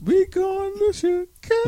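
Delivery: high, slow, stretched out; high drawn-out voice. Pretend. Silly sung-out line.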